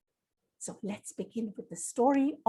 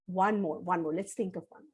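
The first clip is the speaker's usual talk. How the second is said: ordinary delivery, nothing marked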